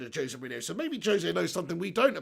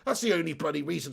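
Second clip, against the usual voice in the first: ordinary delivery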